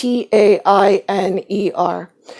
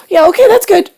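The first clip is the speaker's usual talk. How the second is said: much higher and louder, bright eager yes; excited agreement; 12 semitones higher, 6 dB louder, much faster than usual. Retold or imitated. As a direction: jump at the idea, chirpy and thrilled. Child happily agreeing with adults.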